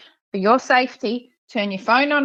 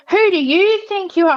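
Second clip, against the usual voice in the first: higher, louder mock challenge; imitating his challenge; 10 semitones higher, 4 dB louder than usual. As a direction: throw his indignant question back, pitched up. Imitating the other driver.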